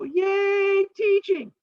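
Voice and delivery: very high squeaky mock cheer; mock cheering crowd. Townspeople cheering at Aesop's offer, high squeaky mock cheer. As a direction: cheer like a dim delighted crowd. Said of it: "And they go".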